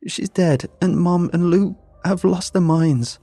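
high, loud desperate plea; frantic pleading. Acted. Daughter begs dad to go see grandma's body. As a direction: beg him, near panic.

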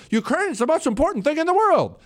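high-pitched, fast parody of politicians; mock politician. Host imitating out-of-touch politicians for listeners, high mock urgency. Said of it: "our politicians will still get up and say".

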